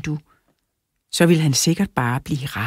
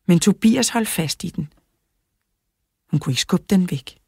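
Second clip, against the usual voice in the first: ordinary delivery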